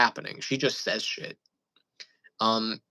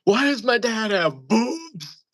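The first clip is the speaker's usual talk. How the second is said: put-on high, slow drunken mom voice; 9 semitones higher, 5 dB louder, slower than usual. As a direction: imitate a tipsy mother's baffled question. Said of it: "she was like".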